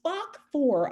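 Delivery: ordinary talk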